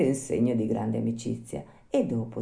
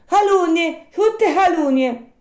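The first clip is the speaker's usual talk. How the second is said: very high, loud puppet cry; outraged squeaky shout; indignant protest; 8 dB louder, slower than usual. Acted. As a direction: shout down the slander, stretching it out.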